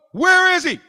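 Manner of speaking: angry high shout; mad demand. Acted. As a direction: bark the question like a furious Christ. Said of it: "and gets mad".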